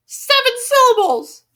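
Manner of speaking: loud, high, stretched-out outburst; exasperated exclamation. Real, not acted. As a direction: cry it out in disbelief. Exclaims the count in frustration.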